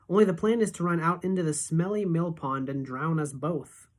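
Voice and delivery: plain reading voice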